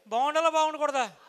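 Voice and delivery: loud crowd prompt; rousing call. Real, not acted. Speaker urging parents to answer, loud rousing question.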